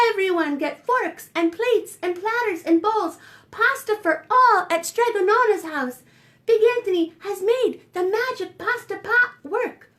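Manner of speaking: high, loud proud announcement; shouting. Acted. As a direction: call out to the whole square. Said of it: "and shouted".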